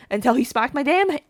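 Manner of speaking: usual speaking voice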